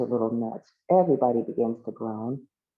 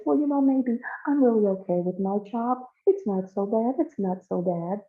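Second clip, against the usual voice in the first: higher-pitched anxious backpedalling; lightly acted nerves, pitched up; nervous; 7 semitones higher than usual. Connie nervous at the change of things, backpedalling. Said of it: "she's become nervous, more so nervous".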